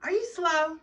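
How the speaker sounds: loud, high incredulous insult; furious. Real, not acted. Ranting about the girl's puppy lie, loud incredulous insult. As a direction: spit it out in disbelief.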